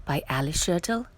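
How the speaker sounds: gentle storybook title read; hushed bedtime-story opening; reading a picture book title. A narrator's reading. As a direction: announce the book softly to a child. Reads title to children.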